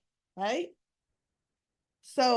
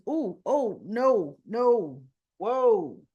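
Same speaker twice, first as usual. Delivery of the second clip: emphatic refusal; 7 dB louder, much slower than usual. Retold or imitated. Phyllis acted, rebuffing Sharon.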